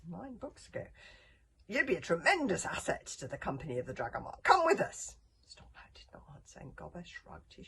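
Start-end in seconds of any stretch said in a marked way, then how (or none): 1.7-5.2 booming praise rising to a shout; calling out. A narrator's reading. Gobber recruiting Snotlout to the company, loud call. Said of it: "called Gobber".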